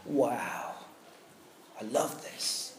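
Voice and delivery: hushed, soft, marvelling over his work; craftsman's tender wonder. Retold or imitated. Craftsman admiring his vase, soft hushed wonder. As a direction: breathe it out like a proud maker. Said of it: "holding it in his hands and going".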